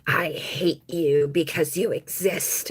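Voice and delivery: slow whispered hateful stranger; voicing a stranger's hateful look; hostile stranger. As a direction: breathe it out like a silent glare. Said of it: "was just like".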